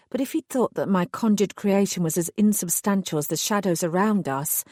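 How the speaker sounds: ordinary delivery, nothing marked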